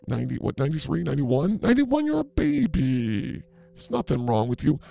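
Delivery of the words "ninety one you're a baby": put-on high, slow baby-talk coo. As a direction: coo it like she is an infant. Exaggerated high cooing.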